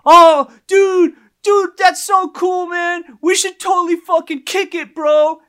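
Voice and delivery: high, loud, overjoyed bro voice; imitated excited dude. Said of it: "he forgot his Jamaican accent".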